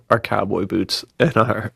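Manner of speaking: ordinary delivery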